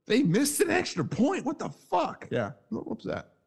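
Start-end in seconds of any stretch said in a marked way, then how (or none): 0.1-2.3 much higher, retelling his stunned reaction; recounting his stunned reaction to the missed extra point, high and incredulous; shocked disbelief. Retold or imitated. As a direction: pitch it up in replayed shock. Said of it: "I rewind and go".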